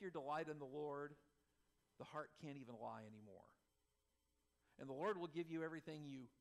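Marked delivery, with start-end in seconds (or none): none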